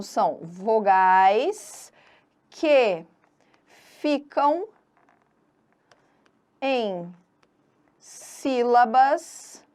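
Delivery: word by word, long pauses between; slow dictation. Shown as a demonstration. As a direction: drag each word, rising until the last. Dictates the definition while writing it. (ditado lento e pausado, palavra por palavra)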